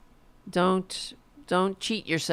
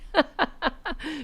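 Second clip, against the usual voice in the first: mocking laugh; 9 semitones higher, 3 dB quieter than usual. Real, not acted. Mocking Melania's romance claim.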